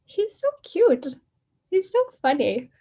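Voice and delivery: gushing over the guru from the back of the hall, high and dreamy; smitten gush. Retold or imitated. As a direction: swoon like a starstruck fan. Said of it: "just sitting there going".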